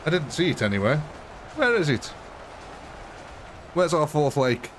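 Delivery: usual speaking voice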